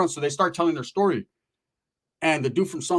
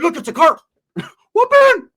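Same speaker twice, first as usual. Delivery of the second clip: high, loud mock yelp; cartoonish high-pitched whoop; excited cousin; 13 semitones higher, 9 dB louder than usual. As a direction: imitate an overexcited cousin shouting out. Acting out excitable cousin spotting fish.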